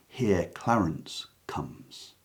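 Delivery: slow, voiceless whisper of a plotting king; whispered aside. Acted. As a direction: let the line sink into a secret whisper. Reciting Richard the Third soliloquy.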